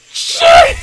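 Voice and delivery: high, loud sudden curse; startled yelp. Real, not acted. Gamer crashing his bike, startled yelp.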